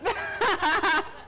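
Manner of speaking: bright, amused laugh at the pan; real burst of laughter. Real, not acted. Laughs at moving eggs.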